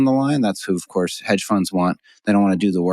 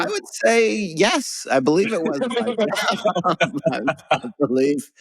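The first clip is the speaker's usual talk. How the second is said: sheepish laugh; 10 semitones higher than usual. Real, not acted. Admitting to friends it was his job, laughing. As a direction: own up while laughing at yourself.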